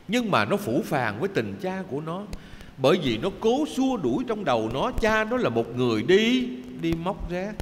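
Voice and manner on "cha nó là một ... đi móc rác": ordinary talk, nothing marked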